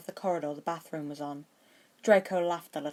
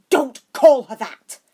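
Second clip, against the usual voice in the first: loud, slow, higher angry snarl; 8 semitones higher, 17 dB louder, slower than usual. A narrator's reading. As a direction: warn him off, furious. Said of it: "he snarled".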